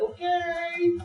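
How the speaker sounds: high, soft, drawn-out okay; meek puppy. Acted. Puppy submitting to mother dog's growl, meek. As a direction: give in timidly in a little voice. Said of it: "the puppy's like".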